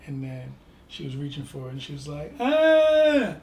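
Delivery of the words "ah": high, loud imitated baby wail; fake baby cry. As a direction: squeal like a baby pretending to cry. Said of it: "fake crying".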